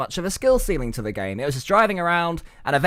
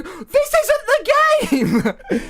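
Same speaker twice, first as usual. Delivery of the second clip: very high, drawn-out mock wail; hammed-up high-pitched outcry; outraged fan protest; 3 dB louder, slower than usual. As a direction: wail it slowly in disbelief. Acting out fans' outraged protest.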